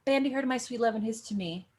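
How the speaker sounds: bandy her to my sweet love tossed off fast and easy; light and quick. Shown as a demonstration. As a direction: fling the words lightly back and forth. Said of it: "It would just be an easy little".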